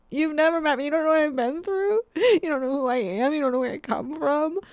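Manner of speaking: high-pitched, wounded customer whine; mock tearful meltdown. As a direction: play it as hammed-up, weepy outrage. Tearful customer meltdown over almond milk.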